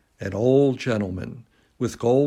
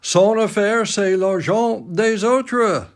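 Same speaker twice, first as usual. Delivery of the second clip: put-on old Baron; 11 semitones higher, 5 dB louder than usual. Old Baron's words quoted by Alan.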